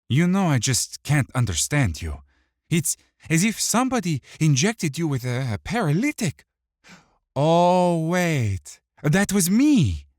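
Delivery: high, loud manic taunt; gleeful psychotic mockery; manic villain. Dirge mocking.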